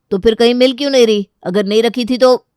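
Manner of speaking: loud, fast, angry rebuke; angry scolding. Acted. Rohan scolding his wife over the missing file, loud and fast. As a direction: snap at her for losing the file. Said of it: "Rohan प्रीति को डांट रहा था".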